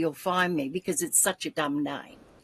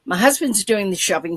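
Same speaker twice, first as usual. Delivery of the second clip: ordinary talk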